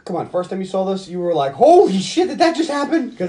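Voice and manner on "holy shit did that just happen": high, loud stunned exclamation; reenacted jaw-drop shock; shocked disbelief. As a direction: blurt it out in amazed disbelief. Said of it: "I did not believe that just happened".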